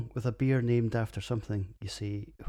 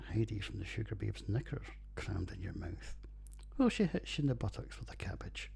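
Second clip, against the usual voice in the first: whispering; 7 dB quieter than usual. Real, not acted. Whispered cheeky aside.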